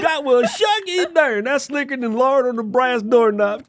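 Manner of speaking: high, hammed-up country twang; mock country accent. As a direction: play a tickled country talker. Mimics the country voice, cracking up.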